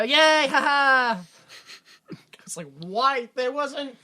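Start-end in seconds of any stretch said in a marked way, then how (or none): 0.0-1.2 loud, high hammed-up yay; mock cheer. Comedian mock-celebrating the end of a gag, loud cheer. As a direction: fake a cheer with a put-on laugh.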